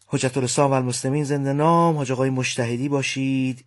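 normal talking voice

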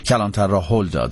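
usual speaking voice

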